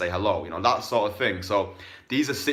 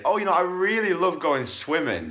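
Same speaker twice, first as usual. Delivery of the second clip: put-on girl's voice; 9 semitones higher, 3 dB louder than usual. Voicing the girl's answer.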